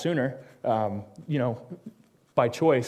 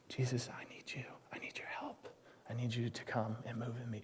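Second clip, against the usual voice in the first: breathy whispered plea; a hushed begging prayer; 3 semitones lower, 19 dB quieter than usual. Shown as a demonstration. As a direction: whisper it, almost silent. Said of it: "it doesn't say".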